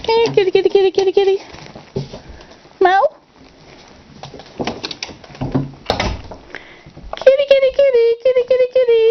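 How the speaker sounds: very high, rapid-fire kitty call; calling the cat. Real, not acted.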